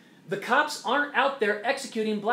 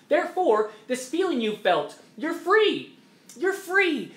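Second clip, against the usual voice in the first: higher, louder, triumphant announcement; higher ringing "you're free"; emphatic proclamation; 9 semitones higher, 3 dB louder than usual. Pretend. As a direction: proclaim freedom like a preacher. Preaching imagined reassurance.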